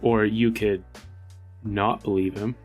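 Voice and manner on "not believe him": ordinary talk, nothing marked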